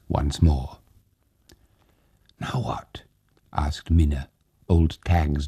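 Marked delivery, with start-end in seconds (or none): 2.4-3.0 breathy whispered question; hushed question. Acted. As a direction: ask it barely voiced, afraid. Minna asks quietly.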